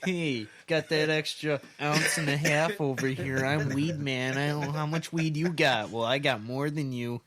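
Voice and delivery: loud bragging show-off voice; mock show-off. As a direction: brag like a cocky dealer. Mocking friend with extra weed ounces.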